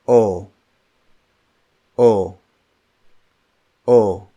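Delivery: O as a pure rounded "oh". Shown as a demonstration. Teacher models the letter O for learners, rounded "oh". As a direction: round the O, no glide. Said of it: "So it's rounded".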